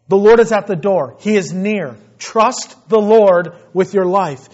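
loud, urgent preaching. Acted. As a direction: proclaim it to the church. Preaching at full force.

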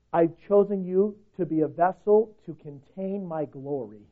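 ordinary delivery

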